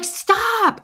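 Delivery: loud, high yell to stop someone; re-enacted street holler; retold screaming. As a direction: shout it after someone far ahead. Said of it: "And I'm screaming in Ecuador".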